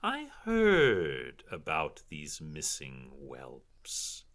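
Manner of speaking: slow, drawn-out villainess voice; condescending drow noblewoman, savoring each word; haughty drow matron. Acted. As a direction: purr it slowly with disdain.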